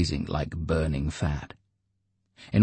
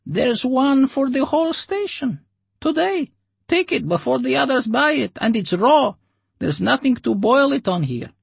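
very high, louder put-on voice; dealer Oleg; 5 dB louder than usual. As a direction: explain it like a shady dealer. Oleg explains.

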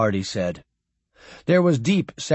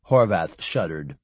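ordinary delivery

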